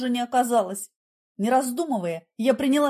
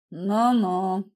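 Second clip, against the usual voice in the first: low, drawn-out sceptical drawl; mocking; 5 semitones lower than usual. A narrator's reading. Igor mocks the heroine's story, derisive. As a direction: humour her with lazy scorn. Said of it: "усмехнулся Игорь и издевательски зевнул".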